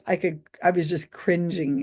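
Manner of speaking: ordinary talk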